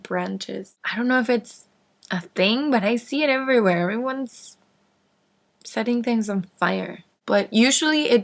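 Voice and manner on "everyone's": ordinary talk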